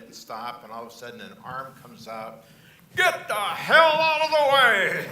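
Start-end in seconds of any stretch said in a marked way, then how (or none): 3.0-5.1 furious bark out the window, much higher and louder; angry yell. Retold or imitated. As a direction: yell at the driver to move. Jack yelling from car.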